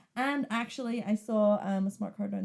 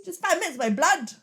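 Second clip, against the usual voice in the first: higher, louder put-on English voice; mock English accent; 8 semitones higher, 6 dB louder than usual. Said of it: "Mary Poppins?".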